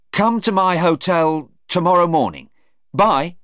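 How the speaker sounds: high, loud hurried goodbye; a hint of a panicked Stephen; nervous Stephen. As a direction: get out of there fast.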